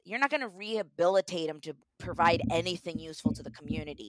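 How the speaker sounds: ordinary talk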